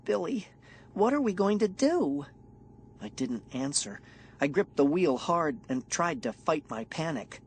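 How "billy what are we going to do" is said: put-on high, worried girl voice; anxious girl asking; scared girl.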